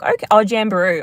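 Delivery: ordinary talk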